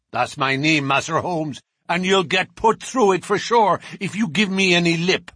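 swaggering thug; bragging bruiser. A narrator's reading. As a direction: puff up and warn him. Bruiser threatens.

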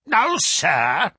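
irate professor's challenge; angry. A narrator's reading. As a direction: round on the visitor angrily. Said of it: "glaring angrily at my companion".

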